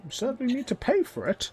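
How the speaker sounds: very high goblin voice; goblin demanding payment. Acted.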